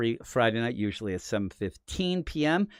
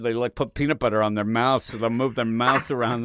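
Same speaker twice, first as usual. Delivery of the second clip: usual speaking voice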